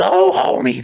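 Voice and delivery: lightly voiced cartoon mom; a hint of Marge; Marge Simpson. Shown as a demonstration. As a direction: Marge voice, half-hearted. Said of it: "try Marge Simpson".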